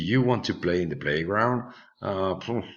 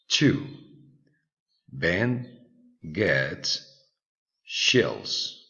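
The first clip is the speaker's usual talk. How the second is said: slow dictation read; much slower than usual. Shown as a demonstration. Reads listening exercise aloud.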